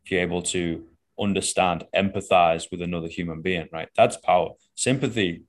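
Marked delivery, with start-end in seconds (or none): none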